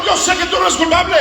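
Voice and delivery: high, fast shouted avowal; fervent preacher voicing the king; impassioned assurance. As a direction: shout it urgently to Daniel.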